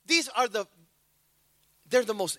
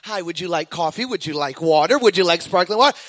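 imitating flight attendant; 6 semitones lower, 7 dB louder than usual. Flight attendant serving calmly.

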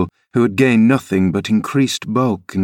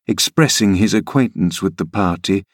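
plain reading voice

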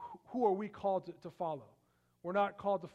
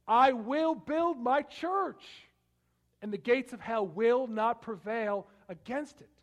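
high, slow, loud declaration; ringing voice of Christ's promise; triumphant proclamation; 5 semitones higher, 8 dB louder, slower than usual. Acted. Preacher quoting Jesus' promise.

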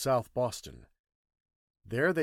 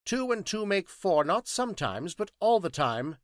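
enigmatic; 9 semitones higher, 7 dB louder than usual. Acted. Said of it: "he went on enigmatically".

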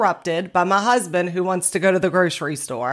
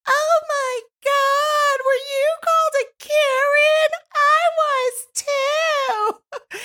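very high, slow mock scream; hammed-up squealing stranger, words stretched out; screaming woman; slower than usual. Stranger in the grocery store overhears the story, mock screaming. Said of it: "out of nowhere screams to the grocery store".

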